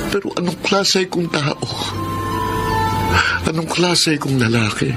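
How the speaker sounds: tormented, tearful question; anguished self-reproach. Acted. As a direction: reproach yourself in despair. Man in self-reproach, listener consoling.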